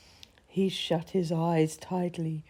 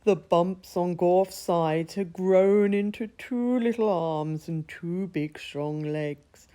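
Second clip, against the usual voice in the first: ordinary delivery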